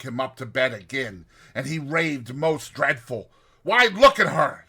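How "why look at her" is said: high, loud horrified cry. Retold or imitated. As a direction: cry out at a ghost only he sees. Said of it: "he cries out".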